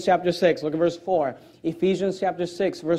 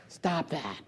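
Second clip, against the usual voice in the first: much softer, lower limp little reprimand; mock soft scolding; 4 semitones lower, 13 dB quieter than usual. Preacher mocks a too-soft parent scolding their kid, very quiet. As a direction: say it like a pushover parent.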